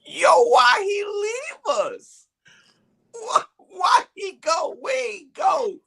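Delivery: very high slow crying shout; hammed-up crying at the burial; mock sobbing wail. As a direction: wail it like a grieving high man. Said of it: "I just start crying and laughing".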